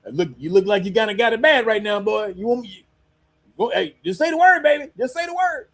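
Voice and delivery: high, teasing mock voice; taunting tough guy. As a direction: taunt like a smug bully. America taunting Mexico, high voice.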